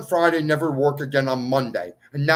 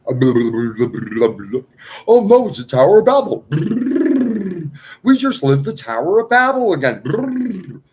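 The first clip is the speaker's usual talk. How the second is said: alarmed outcry; 9 dB louder than usual. Real, not acted. Lamenting to his viewers in a loud, alarmed outcry. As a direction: cry out as if disaster struck.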